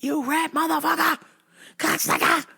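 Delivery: high, strained mock rage; losing it. Man losing it at the melted pump, high mock rage. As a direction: curse the pump in a high retold fit. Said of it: "I was just fucking losing it".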